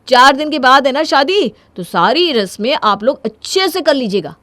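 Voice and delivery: loud, high, emphatic show of not caring; defiant bravado. Acted. Husband ordering wedding decorators about, loudly defiant.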